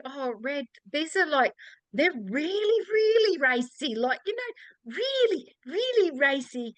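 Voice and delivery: put-on embarrassed warning to an old lady about racy books; awkward warning. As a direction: warn her gently but awkwardly, leaning on really. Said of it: "And I'm going".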